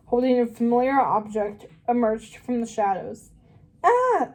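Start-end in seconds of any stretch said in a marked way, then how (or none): 3.8-4.3 a very high startled scream; the girl screams at the chef in the dark. Acted. Piercing high shriek of fright. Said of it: "screamed the girl".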